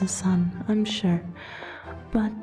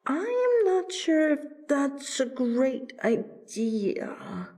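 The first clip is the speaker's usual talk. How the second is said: reluctant; 9 semitones higher, slower than usual. Acted. Reluctant to dance with Nicole.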